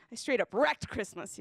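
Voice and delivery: usual speaking voice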